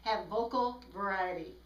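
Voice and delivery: normal talking voice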